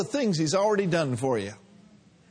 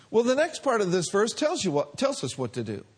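normal talking voice

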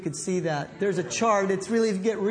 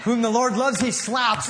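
hammed-up pained yelp, then a mock stern verse; half-laughing parody of a harsh correction; mock harsh rebuke; 4 semitones higher than usual. Preacher mocking his old idea of a rebuke. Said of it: "I always thought it was".

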